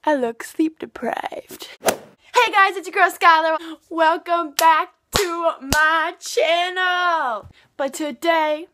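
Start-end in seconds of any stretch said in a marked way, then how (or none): 1.8-7.5 excited intro. Acted. YouTuber intro greeting.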